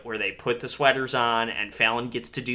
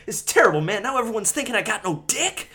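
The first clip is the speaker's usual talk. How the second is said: mock distress; 10 semitones higher, 3 dB louder than usual. Acting out an upset movie character.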